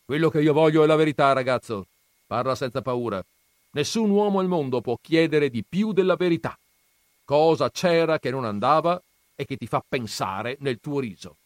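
un nonno severo appena accennato, più forte. Acted. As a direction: interroga il ragazzo con autorità. Nonno interroga il ragazzo. (nonno che interroga il nipote, tono severo leggero)

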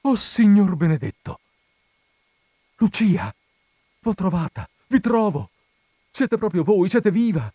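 high, loud cry of joy; a hammed-up ecstatic shout; overjoyed cry. A narrator's reading. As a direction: shout her name in disbelief and joy.

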